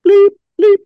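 very high, loud beeps; loud, high-pitched machine bleeping; imitated checkout beeps. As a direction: mimic a scanner with piercing high bleeps. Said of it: "the lady comes over and she's like".

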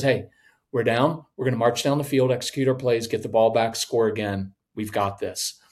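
low, even, steady leader; calm and cool. Acted. Quarterback kneeling in the huddle, calm and cool. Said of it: "stay calm and cool under pressure".

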